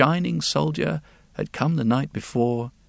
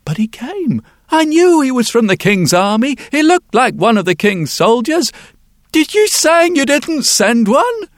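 high, loud, rising panic; panicked disbelief; 11 semitones higher, 8 dB louder than usual. Acted. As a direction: voice climbing in alarm. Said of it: "said the wheelwright".

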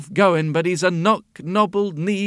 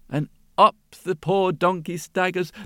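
plain reading voice

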